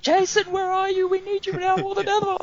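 very high, hammed-up cry for rescue; mock desperate plea. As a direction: plead melodramatically in a squeaky high voice. Acting out desperate plea for help.

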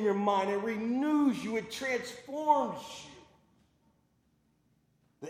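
loud, high, fervent preacher declaring scripture; preaching at full force. Acted. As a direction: proclaim it to the whole congregation.

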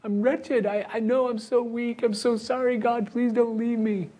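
high, pleading, ashamed voice; pleading sinner. Acted. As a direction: beg it like a broken believer. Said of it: "And we go".

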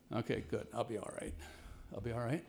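hushed I'll be all right to himself; quiet self-reassurance. Retold or imitated. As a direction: murmur it to calm yourself. Quietly reassuring himself after injection.

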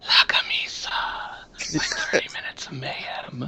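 soft breathy whisper. Retold or imitated. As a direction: whisper it like a sultry ad. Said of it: "me going".

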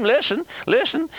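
urgent high call to listen. Real, not acted. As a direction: call the congregation to attention. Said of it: "preacher calling attention".

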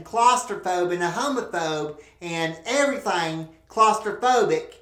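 normal talking voice

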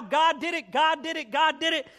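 high, loud acted scream; rapid-fire jubilant yelling. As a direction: shout it over and over in mock frenzy. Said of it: "screaming about how".

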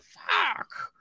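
high, hushed what-happened; mock startled. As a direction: viewer jolted out of the film, mock startled. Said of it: "And you're like".

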